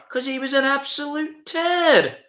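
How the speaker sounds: lingering on turd, high and slow; Chopper Read impression. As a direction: play the tough guy with a slow sneer. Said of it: "I used to try and do the Chopper Read stuff".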